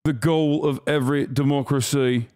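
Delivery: mock British accent reading a statement. Streamer mocking the official statement in a British accent. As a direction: read it as a smug British official. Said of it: "British accent".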